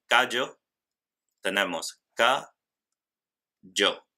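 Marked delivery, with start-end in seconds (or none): 2.1-4.1 "ca, yo" with a long gap between syllables; rising "ca", pause, then "yo"; split into syllables. Shown as a demonstration. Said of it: "Dos sílabas". ("ca, yo" separado en dos sílabas)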